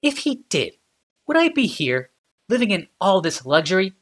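sarcastic. Acted. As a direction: drip with irony. Ashnod sarcastic.

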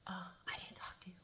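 hushed, breathy inmate whisper at the window. Retold or imitated. Inmate whispering to the officer through the glass. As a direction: keep it secret, barely any voice.

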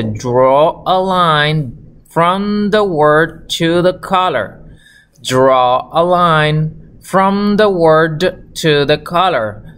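very slow English with stretched words; slow model reading. Shown as a demonstration. Teacher reads the exercise to learners, slowly. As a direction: read it word by word for beginners.

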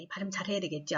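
ordinary delivery

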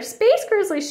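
put-on high-pitched frightened child's wail; storybook wail. A narrator's reading. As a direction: wail it in mock terror. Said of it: "she wailed".

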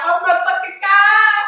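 high, loud shriek of disbelief; slow, shrieking oh my God; shocked shout. Retold or imitated. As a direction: scream it in horror as the fight breaks out. Shocked seeing friend fight girl.

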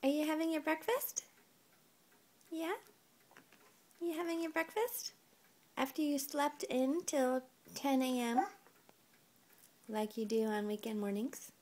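put-on high sing-song baby talk; reading to a toddler. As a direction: coo it to a little one. Parent talking to toddler.

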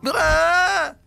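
loud high scream; terrified scream. A narrator's reading. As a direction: scream in sudden fright. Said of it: "J'ai hurlé".

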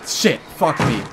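loud startled curse; sudden loud outburst of alarm; startled swearing. Real, not acted. Startled while game saves.

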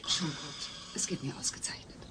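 soft unvoiced whisper. Kate leaving for hospital, whispering. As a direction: whisper so Alf stays asleep. Quiet night departure.

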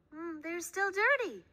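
put-on high sweet girl; little girl voice. A narrator's reading. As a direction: talk like a small girl. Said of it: "said May".

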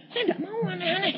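high, fast whiny objection; retold protest. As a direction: protest in a quick, high-pitched voice. Retold startled protest to God.

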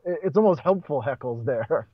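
usual speaking voice

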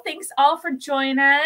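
ordinary talk, nothing marked

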